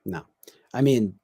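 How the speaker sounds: ordinary talk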